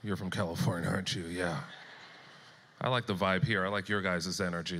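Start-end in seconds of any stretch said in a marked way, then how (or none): 0.0-1.6 knowing Texan. Retold or imitated. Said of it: "spot a Californian in Texas".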